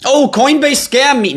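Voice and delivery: very high whining mimic; mocking whiny victim. Streamer mocks scammed users, high whiny mimicry. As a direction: whine it like a clueless victim.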